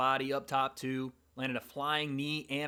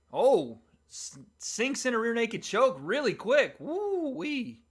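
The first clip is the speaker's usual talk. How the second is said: excited play-by-play; 9 semitones higher, 7 dB louder, slower than usual. Real, not acted. Calling a sudden choke.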